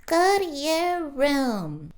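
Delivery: high, slow put-on mom voice; mock stern mom. Baby mom sending child to her room, high and slow. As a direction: stretch it out like a baby mom scolding. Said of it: "And the mom's like".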